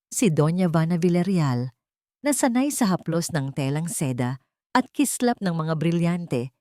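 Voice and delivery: ordinary delivery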